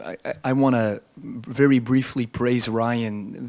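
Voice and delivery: ordinary delivery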